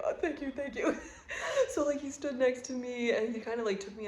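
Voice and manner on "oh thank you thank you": tearful laughing thanks. Retold or imitated. Thanking stranger while crying.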